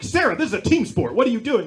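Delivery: loud, high sideline yell at a player; scolding coach. Retold or imitated. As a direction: holler like an exasperated kids' coach. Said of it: "the coach is like".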